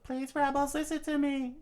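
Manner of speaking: pleading in very high falsetto; Vivi. Acted. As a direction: silent plea voiced high. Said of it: "Vivi thought to herself".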